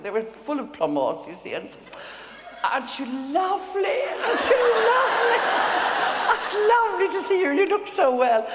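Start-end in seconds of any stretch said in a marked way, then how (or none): 2.6-8.5 high, bright mock-gushing ladies; imitating two gushing women, laughing; gushing society ladies. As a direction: ham up the fake sweetness, laughing.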